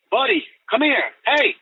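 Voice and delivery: retold call to a missing dog, pitched high. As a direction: call your dog from the woods, high but not yelled. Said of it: "I started calling out to him".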